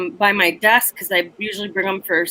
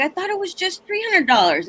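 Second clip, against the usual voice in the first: hoe's voice; 8 semitones higher than usual. Retold or imitated. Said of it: "the hoe's like".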